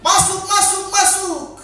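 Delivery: put-on high-pitched calling-out, as a father ordering a child in; imitated parental shout. Father with rattan calls child from street's end, shouting. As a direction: act out a dad yelling down the street. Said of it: "berteriak, katakan".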